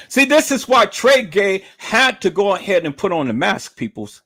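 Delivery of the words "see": normal talking voice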